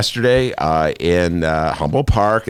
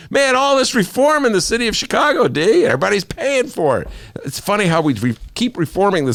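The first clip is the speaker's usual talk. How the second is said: sarcastic; 13 semitones higher than usual. Real, not acted. Sarcastic rant on reform.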